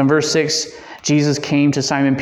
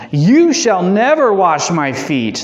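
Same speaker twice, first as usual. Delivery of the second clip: louder, slow, higher, hitting "never" hard; emphatic refusal; 6 semitones higher, 5 dB louder, slower than usual. Acted. Peter refusing Jesus: loud, slow, higher refusal. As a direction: put the whole strongest negative into it. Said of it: "It's the strongest negative in the Greek possible".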